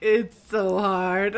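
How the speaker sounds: slow, loud mock whine; imitating a woeful guard, every word stretched; whining guard. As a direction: drag out the complaint theatrically. Acting the guard's complaint.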